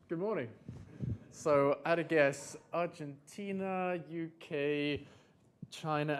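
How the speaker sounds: normal talking voice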